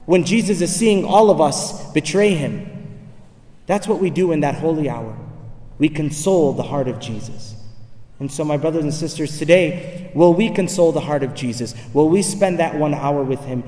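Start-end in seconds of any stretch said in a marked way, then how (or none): none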